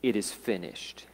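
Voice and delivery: low, hushed finality; quiet last words. Retold or imitated. Jesus dying on the cross, quiet last words. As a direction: say it softly, letting it sink. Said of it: "And then Jesus said".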